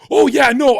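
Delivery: high and loud flustered imitation; squeaky, loud mock backpedal. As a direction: play him caught out and scrambling. Mocking friend's flustered backpedal.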